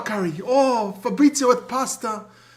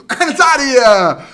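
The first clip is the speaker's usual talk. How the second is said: loud catchphrase; 3 semitones higher, 8 dB louder than usual. Acted. Catchphrase segment ending.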